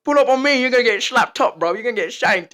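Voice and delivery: loud, high British roadman threat; British accent. Retold or imitated. Gamer acting tough with the boys, loud British accent. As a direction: threaten loudly in put-on British slang. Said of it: "going full on British".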